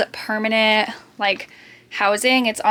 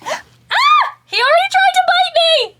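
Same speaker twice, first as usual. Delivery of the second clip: high loud startled yelp; startled shriek; 7 dB louder than usual. Real, not acted. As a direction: jump back with a shriek. Hamster tries to bite.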